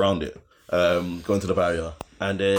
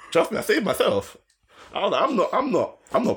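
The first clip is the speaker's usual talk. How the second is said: excited; 12 semitones higher than usual. Real, not acted. Laughing, insisting.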